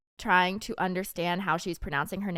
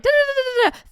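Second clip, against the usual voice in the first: high, loud rapid-fire babble; high breathless run of nonsense syllables; mocking imitation of rapid talker; 7 dB louder, faster than usual. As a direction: mimic co-host rattling on without a breath. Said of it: "You're always like".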